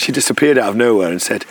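ordinary delivery

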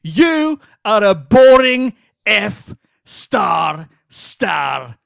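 slow, loud, word-by-word Scottish-style tirade; mock Scottish insult. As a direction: hammer each word in loose Scottish, exploding on the last. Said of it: "whatever accent he's doing".

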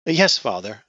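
stammering. Acted. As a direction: stammer it out meekly. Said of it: "Abby stammered".

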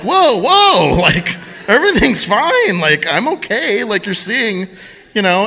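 high-pitched mock alarm, settling gradually; retold startled reassurance. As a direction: start high on whoa, then ease down. Retells calming down the shocked woman.

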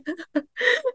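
very high, short laughing squeal; high squeal of laughter. Real, not acted. Guest laughing at herself while describing her faith.

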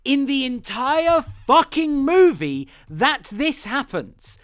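exasperated emphasis. Real, not acted. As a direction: hammer it home in disbelief. Exasperated rant about the movie.

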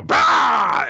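loud, high mock yell; sudden hammed-up blast of a shout; shouted battle cry. Kusanagi and Dora blow away the mirage, battle yell.

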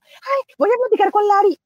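high, loud gush of excitement; excited. Retold or imitated. Telling her husband about the Lari chat, excited and high. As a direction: burst out with it like she can't wait. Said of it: "y me emociono y voy y le digo a mi esposo".

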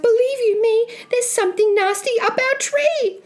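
put-on high-pitched panicky young Jack; gasping fright. Boy telling mum of something nasty, high frightened cry. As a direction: blurt the scare out high to Mum. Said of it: "he gasped".

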